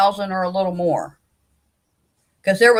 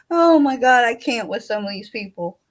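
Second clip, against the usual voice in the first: normal talking voice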